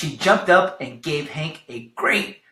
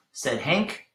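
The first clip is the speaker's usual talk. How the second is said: plain reading voice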